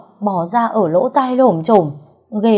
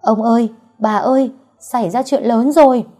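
higher, louder panicked calling out; frantic cry; 5 semitones higher, 4 dB louder than usual. A narrator's reading. Servant boy rushing in with bad news, frantic yell. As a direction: servant bursts in yelling for his masters. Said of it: "lật đật kêu gào".

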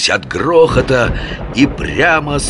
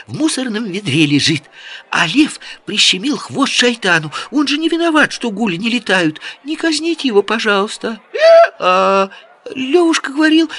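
put-on high, rapid girlish chatter. A narrator's reading. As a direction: rattle it off like an excited girl. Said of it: "быстро затараторила Джамиля".

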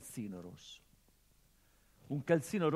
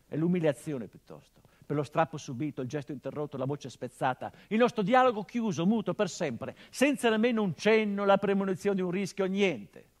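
rising anguished outburst; 8 semitones higher, 13 dB louder than usual. Acted. Son to his dead father, an anguished swelling cry. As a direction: voice climbs higher and much louder, line after line.

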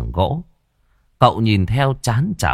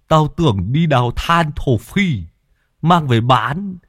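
higher, jokey needling voice; teasing crony; 6 semitones higher than usual. Acted. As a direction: tease him in a raised character voice. Teasing Môn Phọt in story. (giọng cao trêu chọc)

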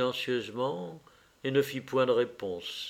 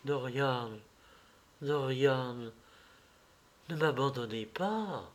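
breathed plea; 6 dB quieter than usual. Acted. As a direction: plead under your breath, with pauses between. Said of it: "souffla-t-elle". (supplication soufflée)

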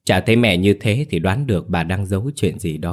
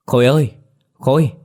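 calling higher and louder across the room; 6 semitones higher, 4 dB louder than usual. A narrator's reading. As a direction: call the boy's name, raised and bright. Sister calls out to the boy. (gọi to, cao giọng)

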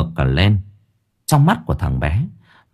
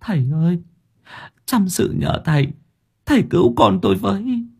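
higher, tearful begging; mother begs the master to save her son, higher; choked sobbing plea; 11 semitones higher than usual. A narrator's reading. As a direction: plead through stifled tears. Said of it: "tức tưởi".

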